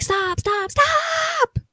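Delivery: high loud building stop; imitating a panicked shout; mock yell. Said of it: "She's like".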